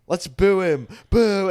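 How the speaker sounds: loud, high "boo!" to rally the room; mock crowd boo. Host getting the audience to boo, loud mock call. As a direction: lead the crowd in booing. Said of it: "And he's like".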